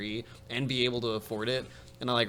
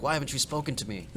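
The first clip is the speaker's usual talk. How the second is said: put-on German accent; 4 semitones higher, faster than usual. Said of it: "sounds German".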